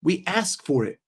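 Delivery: ordinary delivery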